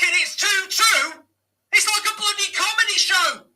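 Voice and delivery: furious shout. Real, not acted. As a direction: scream it at the screen in disbelief. Team concedes, fan erupts.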